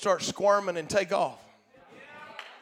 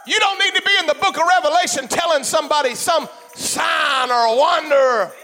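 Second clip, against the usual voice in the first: loud, high, shouting preacher; fiery preaching; 8 semitones higher, 5 dB louder than usual. Acted. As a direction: preach it full force, building. Preaching at full force.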